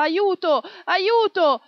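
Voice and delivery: loud, high cries for help; desperate shout. A narrator's reading. People outside the theatre pleading for help, desperate shouting. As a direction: scream for help, panicked. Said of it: "La gente gridava".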